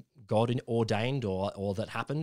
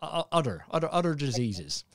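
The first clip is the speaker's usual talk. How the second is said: repeating odder in a light Cornish accent; mild Cornish accent; 2 semitones higher than usual. Shown as a demonstration. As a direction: stretch out odder, Cornish style. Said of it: "break out the Cornish accent".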